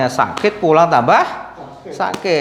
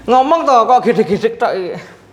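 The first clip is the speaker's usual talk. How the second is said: laughing; 7 semitones higher than usual. Real, not acted. Patient laughing, therapist teases.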